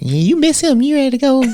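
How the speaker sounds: high mocking girlfriend voice; imitating Tiara. Quoting his girlfriend teasing him, in a high voice. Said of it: "she's like".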